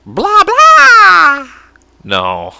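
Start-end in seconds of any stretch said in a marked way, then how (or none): 0.1-1.5 high, loud puppet as vampire; Elmo. Acted. Joking about a vampire puppet, piercing squeal. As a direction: squeal a mock vampire catchphrase. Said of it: "Vampire Elmo".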